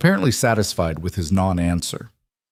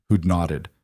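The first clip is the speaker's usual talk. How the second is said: ordinary delivery, nothing marked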